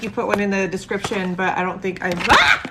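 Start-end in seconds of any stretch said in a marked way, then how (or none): 2.3-2.6 sudden loud, high yelp; startled yelp. Real, not acted. Streamer cut off by a mishap mid-sentence, startled yelp. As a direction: cry out in shock.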